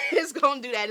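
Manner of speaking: laughing as she says it. Real, not acted. Repeats the line laughing.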